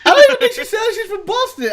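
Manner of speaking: high, fast, laughing through it; laughing disbelief. Real, not acted. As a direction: laugh as you protest.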